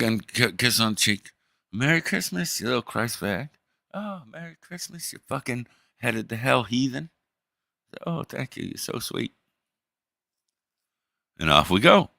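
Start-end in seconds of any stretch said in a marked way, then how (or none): 1.7-3.5 high, sugary holiday cheer; a fake-sweet holiday greeting pitched high; mock-sweet greeting. 3.9-7.1 high and hushed, syrupy sweet; sweet on top, venom underneath; high, hushed fake cheer to a relative; sugary fake greeting. 8.0-9.3 high, soft, almost whispered; a hushed thank-you dripping with sweetness; a relative whispering a sugary thank-you; breathy sweet reply. Pretend.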